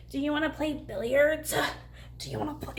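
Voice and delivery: soft, coy imitation of flirting; hammed-up flirty invitation, kept quiet; mock flirty. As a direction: tease it softly, mocking her flirtation. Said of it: "She's, like, being all flirty and shit".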